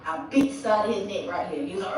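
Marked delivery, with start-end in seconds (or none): none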